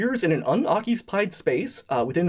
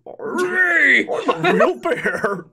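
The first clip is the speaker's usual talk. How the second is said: higher, laughing shouted bear; 12 semitones higher, 5 dB louder, much slower than usual. Acted. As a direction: shout it out, delighted. Bear suddenly real.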